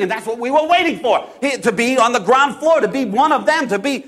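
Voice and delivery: usual speaking voice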